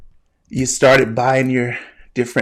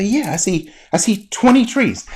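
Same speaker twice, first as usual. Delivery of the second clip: insistent reply; 7 semitones higher than usual. Acted. Imagined learner insisting on the answer.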